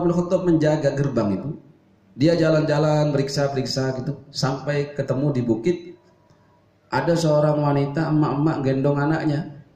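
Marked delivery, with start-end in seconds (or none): none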